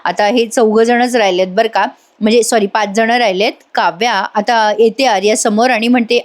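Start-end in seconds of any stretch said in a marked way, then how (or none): none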